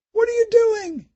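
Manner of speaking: put-on high, alarmed child's question; frightened girl. A narrator's reading. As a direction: ask in bewildered fear. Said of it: "she asked".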